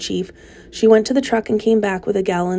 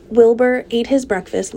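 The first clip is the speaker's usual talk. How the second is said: plain reading voice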